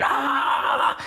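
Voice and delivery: high-pitched mock scream; drawn-out high "aah" imitating a scream; retold scream. Storyteller imitating the woman's scream in the car, high-pitched. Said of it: "yelling and screaming".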